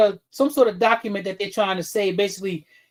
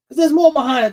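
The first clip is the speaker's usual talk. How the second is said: louder, higher, driving the point; heated outburst, getting louder; rising rant; 5 semitones higher, 7 dB louder than usual. Real, not acted. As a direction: raise your voice and push it hard. Ranting, voice rising.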